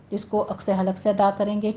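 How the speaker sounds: ordinary talk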